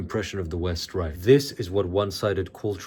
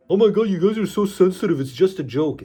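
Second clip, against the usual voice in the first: high, loud, rushed mock complaint; mocking whiner; 9 semitones higher, 5 dB louder than usual. Critics scolding the offended for taking a joke, mocking whine. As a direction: mimic critics in an exaggerated high-pitched rush. Mocks critics' reply to him.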